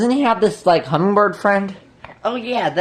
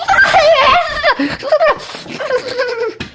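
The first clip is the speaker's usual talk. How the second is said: high cackle. Acted. Laughing after knocking Carl off.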